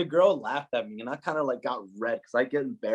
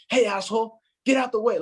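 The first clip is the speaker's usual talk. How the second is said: very high, louder mock yell; mock rude shout; 11 semitones higher, 4 dB louder than usual. German guy snapping at him in the club, mock yell. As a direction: bark it like a rude clubgoer. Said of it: "one guy was like".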